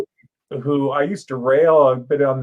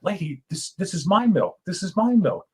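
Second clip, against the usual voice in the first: muffled, softer, a bit higher plea; 6 semitones higher, 3 dB quieter than usual. Pretend. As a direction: talk as if through a hand over the mouth. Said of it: "And I muffled".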